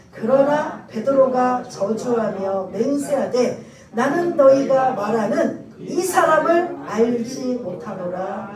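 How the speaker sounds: loud, slow congregational Bible reading; scripture reading. Acted. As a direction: lead the congregation through the verse, slowly and loudly. Leads scripture reading aloud.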